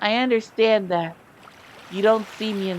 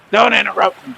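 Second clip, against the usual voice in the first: firm; 3 semitones higher, 6 dB louder than usual. Acted. Reading heroine's line firmly.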